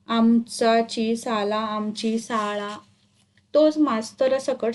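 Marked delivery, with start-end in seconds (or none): 0.1-1.6 "आमचाची साला" dragged out in a slow monotone; stumbling syllable-by-syllable reading. A narrator's reading. Boy misreads the lesson title, "आमचाची साला", haltingly. 1.6-2.8 "आमची साळा" slow, softer and monotone; drawn-out corrected reading. A narrator's reading. Boy corrects the lesson title, "आमची साळा", slowly.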